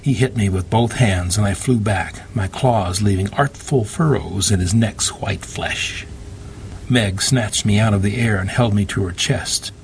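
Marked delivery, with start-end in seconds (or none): none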